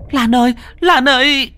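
high, loud panicked calling of her name; frantic call. Acted. Friend cries out to fallen Lan, high loud panicked call. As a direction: scream for her to wake up.

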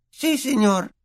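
a tiny servant overjoyed to meet his hero; house-elf Dobby. A narrator's reading.

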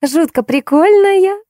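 very high, drawn-out squeal; excited squeal. A narrator's reading. Girl thrilled by the little dog, excited squeal. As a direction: squeal with delight, holding the high note. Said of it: "взвизгнула Лиза".

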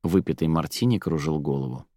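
ordinary delivery, nothing marked

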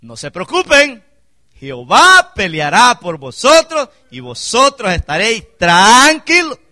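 loud, high, stretched-out proclamation; Moses addressing the people. Retold or imitated. Preacher voicing Moses to the cornered people, loud proclamation. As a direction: proclaim it slowly, drawing out the last word. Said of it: "le dijo al pueblo".

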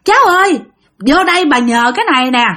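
put-on high, coaxing old woman's call to a child. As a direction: lure the girl over sweetly. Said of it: "bà mới kêu rằng".

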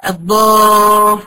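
الضا with the alif held very long and slow; long madd demo. Shown as a demonstration. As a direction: stretch the alif in الضا like in recitation. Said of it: "وبعديه حرف مشدد".

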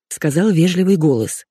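usual speaking voice

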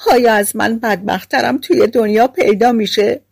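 high-pitched, acted lament of a miserable girl; plaintive storybook wail; tearful wailing. A narrator's reading. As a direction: wail it in self-pity, pitch climbing steeply. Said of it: "ناله و گریه".

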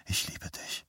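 intimate whisper against the neck. A narrator's reading. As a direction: whisper it tenderly, barely audible. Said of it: "sagte sie gegen seinen Hals".

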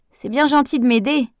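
put-on old lady calling out cheerfully; called out. A narrator's reading. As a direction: call it over to her. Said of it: "lui lança-t-elle".

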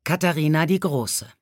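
ordinary delivery, nothing marked

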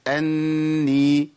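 أني with a long hummed n and oral final i; slow nasal hum ending in a clear vowel; ghunnah, clean vowel. Shown as a demonstration. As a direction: hold the n of أني, then release into a clean i. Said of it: "خلاص ما فيش عندي غنة".

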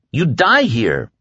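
high-pitched outcry; crying out. Acted. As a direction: cry it out as an urgent warning. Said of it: "Larry cried".

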